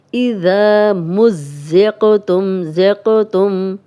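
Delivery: slow, loud, melodic recitation; slow chanted tajweed; Quranic recitation. Shown as a demonstration. Recitation of the verse.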